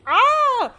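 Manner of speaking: short, high scream; loud yelp of alarm; frightened scream. Real, not acted. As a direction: shriek in sudden fright. Startled by sea creature.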